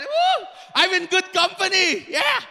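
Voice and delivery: loud, high-pitched whoop of relief; glad celebration. Real, not acted. Preacher glad nobody knows the word either, celebrating. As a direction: cheer that everyone shares your ignorance. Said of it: "I'm so glad that none of us".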